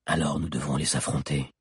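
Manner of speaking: low, resolute character voice; low-pitched sombre resolve; grave conclusion. A narrator's reading. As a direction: state the decision to fight, low. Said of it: "conclut Hakan".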